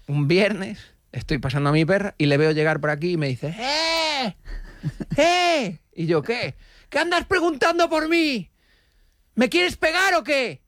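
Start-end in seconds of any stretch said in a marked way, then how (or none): 3.6-5.8 high shouted hey hey; retold high call from a distance. Man calling out in park. 6.2-10.6 much higher, slow, mock-tough challenge; imitating a belligerent dog owner; aggressive stranger. Retold aggressive confrontation.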